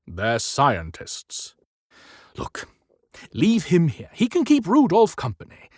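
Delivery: lightly voiced firm old man; Santa's voice. Narrator voices Santa character.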